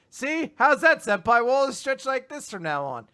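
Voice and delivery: acted-out cheerful eagerness; eager student. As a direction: chirp it up high, pleased. Acting his cheery line.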